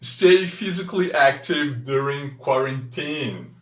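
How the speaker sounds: slow model sentence. Shown as a demonstration. Teacher reading the example sentence slowly to students. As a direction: Stay physically active during quarantine read slowly and clearly. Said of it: "o exemplo que nós trouxemos é".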